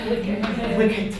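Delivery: wicked said the British way, soft and clipped to /ɪkd/; British accent. Shown as a demonstration. Student says Wicked in British accent on request. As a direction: say Wicked like a Brit, quietly, dropping the w. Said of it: "Could you say that?".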